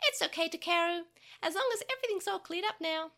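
forgive him kindly; gentle forgiveness. Acted. Tokomon comforting Takeru, high and kind.

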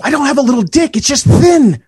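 high whiny cartoon kid; defensive Cartman whine. Cartman impression banter.

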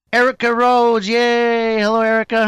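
loud, high, cheering welcome; excited welcome. Real, not acted. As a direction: greet her with big excitement. Host welcoming guest excitedly.

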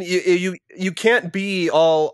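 ordinary talk

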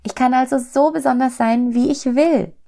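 high, delighted little soul; overjoyed. A narrator's reading. Little soul rejoices at being allowed to be special, overjoyed. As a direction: exclaim with joy in a small high voice. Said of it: "lachte voller Freude".

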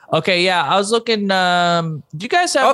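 high, hesitant customer on the phone; role-play caller. Pretend. Customer asking the diner for food, high hesitant voice. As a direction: lift the pitch, fumbling toward an order. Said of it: "Okay, I'ma call in".

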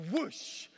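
breathy whoosh of the wind; the wind snatching the chute. Retold or imitated. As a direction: blow out the gust. Wind grabs the parachute.

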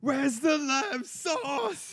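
high, slow roaring chef; furious chef. Pretend. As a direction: bellow it like an outraged head chef. Gordon Ramsay impression.

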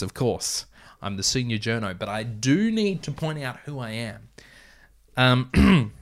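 ordinary talk, nothing marked

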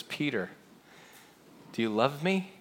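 softly asked, with a pause after the name; gentle question. Acted. Jesus to Peter after a silent breakfast, gently. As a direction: ask it quietly and leave room.

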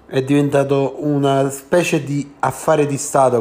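normal talking voice

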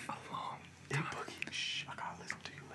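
hushed private whisper. Real, not acted. Whispering privately to his inanimate objects, hushed whisper. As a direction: whisper it very softly, barely voiced. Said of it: "I was whispering".

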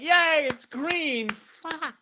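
high mock cheer, then a flat curse. Friends in the car mocking the green light, high mock cheer.

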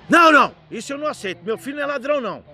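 loud, fast, high outburst; acted indignant refusal. Father defending his son to police chief, indignant. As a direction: burst in loudly, then rattle on fast.

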